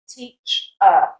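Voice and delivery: teach, pause, then a bare "uh"; schwa ending. Shown as a demonstration. Teacher breaking down British teacher, schwa ending. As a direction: split teach from its weak "uh". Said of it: "you pronounce them with a schwa".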